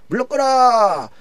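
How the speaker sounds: high, slow drawn-out proclamation shout; herald's cry. Acted. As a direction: cry it out to clear the street. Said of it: "이런 거예요".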